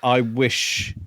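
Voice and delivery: ordinary talk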